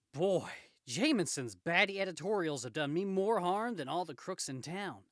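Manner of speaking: lightly voiced, animated complaint; aggrieved Spider-Man. Spider-Man complaining about Jameson's editorials, aggrieved. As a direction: grumble with comic-book exasperation.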